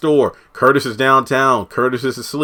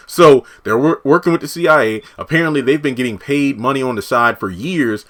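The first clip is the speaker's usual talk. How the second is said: ordinary talk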